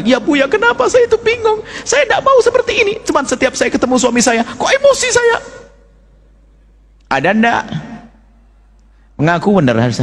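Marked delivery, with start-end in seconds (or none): 0.1-5.4 high, pleading confession voice; confessing wife. Retold or imitated. Preacher voicing a wife confessing to him, high and pleading. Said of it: "Ada pengakuan".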